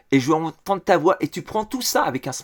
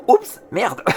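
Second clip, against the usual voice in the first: mock oops; 3 semitones higher, 3 dB louder than usual. Mimics clumsy YouTuber breaking things.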